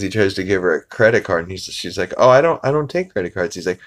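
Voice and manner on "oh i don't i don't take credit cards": put-on higher, faster little girl; a lightly voiced lemonade-stand girl; girl's voice. Girl refusing the credit card, lightly higher and quick.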